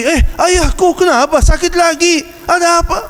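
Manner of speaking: high worried questions; alarmed child. Retold or imitated. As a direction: ask anxiously what is wrong. Children alarmed by father crying. (anak bertanya dengan cemas)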